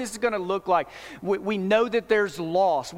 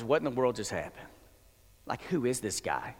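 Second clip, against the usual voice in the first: hushed awe; 8 semitones lower, 7 dB quieter than usual. Acted. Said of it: "their response is".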